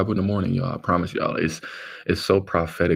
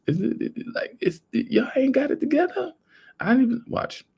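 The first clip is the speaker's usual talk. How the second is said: pitched way up in outraged disbelief; incredulous disbelief. Real, not acted. Venting to viewers about dirty tap water, high incredulous outrage. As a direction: ask it like you can't believe anyone let this happen.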